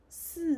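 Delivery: si said high and falling; teacher models si, number four; si demo. Shown as a demonstration. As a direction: drop si down sharply. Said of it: "number four".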